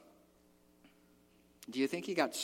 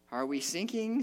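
normal talking voice

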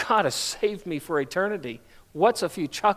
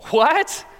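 high incredulous "What?"; a lightly voiced girl, caught off guard; shocked girl; 9 semitones higher, 4 dB louder than usual. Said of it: "she says".

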